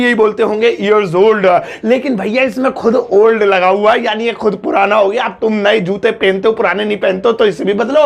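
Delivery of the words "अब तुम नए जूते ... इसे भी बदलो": ordinary talk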